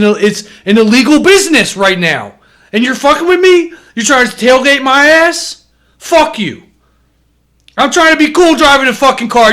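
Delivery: high, ranting complaint; mock road rage. Driver ranting at a tailgater, high mock road rage. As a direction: rant at the driver behind you.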